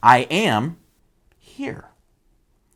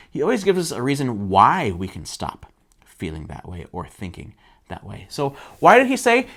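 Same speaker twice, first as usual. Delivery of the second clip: ordinary delivery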